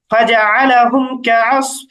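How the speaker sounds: slow, measured Arabic recitation; Quran recitation. Shown as a demonstration. Teacher quoting the last verse of Surah Fil, slow recitation. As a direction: recite the verse slowly, drawing out the syllables. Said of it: "যেমন আমরা সূরা ফিল মশ করেছি".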